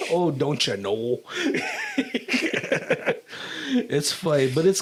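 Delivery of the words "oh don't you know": put-on slow, laughing Minnesota accent; hammed-up Minnesota drawl. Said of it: "we make fun of the people who are from, like, Minnesota".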